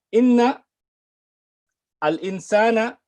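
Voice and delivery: "Inna" and "insana" held up, waiting for an echo; repeat-after-me prompt. Shown as a demonstration. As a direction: call out "Inna", pause, then "al insana" for repeating. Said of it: "one more time with me".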